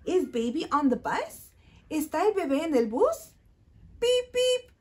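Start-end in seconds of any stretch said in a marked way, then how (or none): 4.0-4.7 beep beep. Shown as a demonstration. Toy bus sound for kids.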